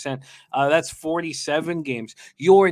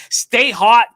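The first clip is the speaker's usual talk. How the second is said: high, loud sign-off shout; shouted catchphrase; 7 semitones higher, 11 dB louder than usual. Acted. Host shouting his sign-off catchphrase to the guest, loud and high. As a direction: punch it out big and high.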